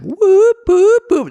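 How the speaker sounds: very high, loud whooping siren; imitated alarm whoops. Actor's inner alarm at sudden blocking, mock-panicked whoops. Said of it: "inside my head, I'm like".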